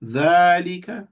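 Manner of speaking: ذلك said slowly with the first vowel held long; extended vowel. Shown as a demonstration. Teacher modelling the lengthened vowel of ذلك. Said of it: "So you extend it".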